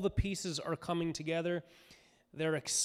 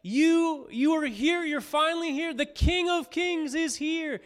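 much higher and louder, jubilant; excited crowd shout; 10 semitones higher, 7 dB louder than usual. Retold or imitated. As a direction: cheer the arriving king. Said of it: "they're shouting".

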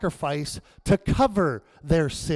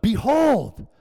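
louder and higher, a proclaiming cry of Behold; 5 semitones higher, 5 dB louder than usual. Retold or imitated. Preacher voicing John the Baptist crying out Behold. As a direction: call out Behold like a herald. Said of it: "and he cries out".

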